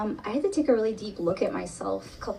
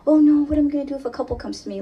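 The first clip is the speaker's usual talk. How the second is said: fast, louder, higher mock worry; mock panic; 5 semitones higher, 7 dB louder, faster than usual. Therapist mocking her old panic about couples, fast and louder. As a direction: rush it out like past-you panicking.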